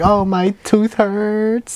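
high, slow singsong complaint; imitating mock whine. Host mimics cohost's complaints, drawn-out high mock whine. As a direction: drag out a silly high moan. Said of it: "Anything that hurts him".

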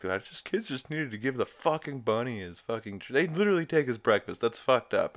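imitating a heated rant. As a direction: get worked up over the stolen cereal. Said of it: "get pretty heated".

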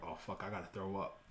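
low, hushed retold dread; quiet aside, imitating sudden sickness; queasy realization. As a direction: drop your voice as nausea hits. Realizing nausea mid conversation.

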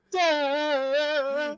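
long, high, loud "Da"; imitated tuba blare. Retelling the tuba video to a friend, imitated blare. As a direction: blare it out like a startled horn. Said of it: "And it goes".

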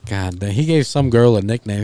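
usual speaking voice

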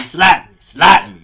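loud demand. Pretend. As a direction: yell it, begging for it. Repeating a request to be slapped.

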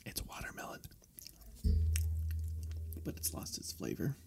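soft whispered aside; whispering. Real, not acted. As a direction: whisper it to yourself. Whispered aside about gum.

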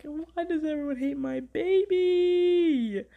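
high, drawn-out plaintive wail; mock wail. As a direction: wail it, stretching out the last word. Acting out her distressed reaction.

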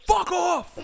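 high, loud mock scream; retold scream. Edgy friend screaming at a kid, loud mock scream. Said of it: "screaming at this kid".

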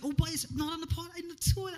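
high sing-song praise to his daughter; talking to a toddler. Retold or imitated. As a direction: coo at a small child. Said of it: "I said".

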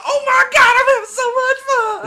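very high, very loud mock squeal; gleeful kid. Imitating the splashed kid, hammed-up gleeful squeal. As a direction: shriek it like an overjoyed kid.

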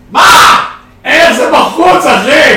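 high, loud slow protest; outraged shout. Real, not acted. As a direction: demand it in disbelief. Disputing call in game. (צעקת מחאה)

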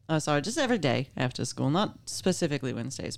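normal talking voice

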